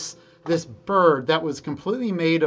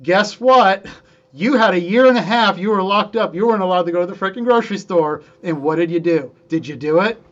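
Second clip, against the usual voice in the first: challenging rant; 3 semitones higher, 6 dB louder than usual. Acted. Scolding listener for not practicing.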